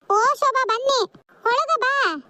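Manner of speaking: welcoming call. Acted. Welcoming Shobha at the door.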